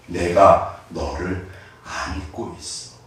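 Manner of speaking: low, very slow, soft and hushed; tender fatherly voice. Acted. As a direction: comfort a frightened child gently. God speaking tenderly to child. (하나님이 아이를 안고 낮고 아주 느리게 속삭이듯 위로함)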